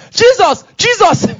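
high alarmed shout; loud, high exclamations, each falling sharply. Acted. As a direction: cry out like angels shouting in alarm. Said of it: "Angels will be shouting".

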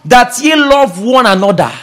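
preaching at full force. Acted. Preacher reads Christ's new commandment to congregation, preaching at full force. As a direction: declaim the commandment with authority.

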